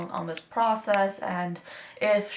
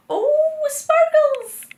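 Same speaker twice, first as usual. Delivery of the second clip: high, loud, drawn-out coo of delight; excited squeal; 9 dB louder, much slower than usual. Real, not acted. Vlogger spots glittery liners, excited high squeal. Gushing over glittery products.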